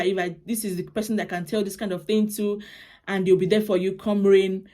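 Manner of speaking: normal talking voice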